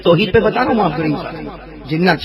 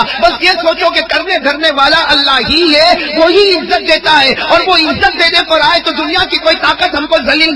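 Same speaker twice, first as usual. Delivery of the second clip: raised, impassioned sermon voice, building toward the oath; fervent preaching; 10 semitones higher than usual. Acted. Preacher urging the congregation to purify hearts, fervent preaching. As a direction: preach it to the crowd with rising fervour.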